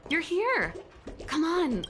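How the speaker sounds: bright, high call of welcome; excited greeting. Acted. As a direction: greet them eagerly, voice jumping up. Greeting someone arriving, excited.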